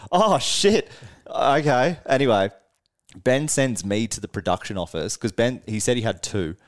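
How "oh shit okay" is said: higher, louder startled "oh shit"; sudden alarm. Retold or imitated. Musician realising the band plays in five minutes, startled mock alarm. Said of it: "then Ben just goes".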